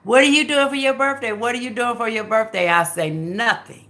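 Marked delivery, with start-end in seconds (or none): none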